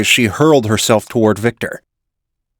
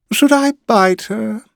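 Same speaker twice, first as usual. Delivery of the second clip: teasing villain; 12 semitones higher than usual. A narrator's reading.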